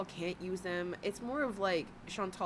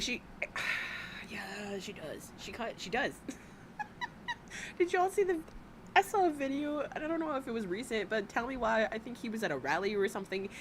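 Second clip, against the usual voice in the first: ordinary talk, nothing marked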